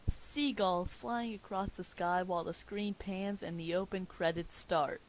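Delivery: usual speaking voice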